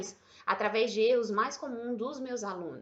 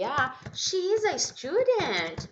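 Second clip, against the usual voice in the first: higher, slow, playful learner voice; mock learner question; 6 semitones higher, 4 dB louder, slower than usual. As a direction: act out the wrong way to ask. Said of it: "não basta fazer o quê?". (imitando aluno, voz mais aguda e lenta)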